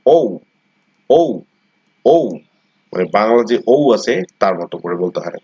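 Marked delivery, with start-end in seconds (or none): 0.1-2.3 ঔ as a gliding ও-উ; correct letter name. Shown as a demonstration. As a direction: round ঔ from ও into উ. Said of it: "এটাকে বলা হয় ঔ". (ঔ-এর মতো করে বলা)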